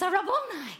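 high, loud cry of recognition; Mary crying out to Jesus at the tomb, high and loud with joy; joyful cry. Acted. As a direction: burst out in sudden joy at seeing him. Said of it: "She cries".

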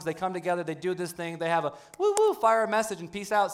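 normal talking voice

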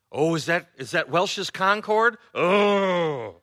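high, slow mock shock at grape juice; hammed-up pearl-clutching surprise; mock offended gasp. Preacher mocks grape juice offense.

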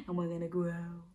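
building from a whisper up high; growing from small. Shown as a demonstration. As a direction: start tiny and grow big. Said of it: "start really small".

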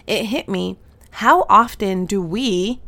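normal talking voice

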